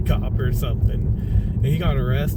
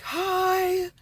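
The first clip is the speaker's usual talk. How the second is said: mock yelled greeting; 4 dB quieter than usual. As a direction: imitate someone yelling hi through glass. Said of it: "I could hear her yelling".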